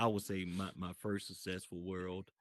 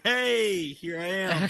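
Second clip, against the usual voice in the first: loud, high attention-getting holler; calling out; 9 semitones higher, 11 dB louder, slower than usual. Retold or imitated. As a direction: shout it out so you get noticed. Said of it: "speaking it out, hoping Meta would hear me".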